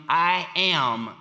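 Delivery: "I am" drawn out slow with weight on "am"; extremely emphatic. Retold or imitated. Jesus answering Thomas, "I am" slow and emphatic. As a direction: lean hard and slowly on "I am". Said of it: "he was extremely emphatic about it".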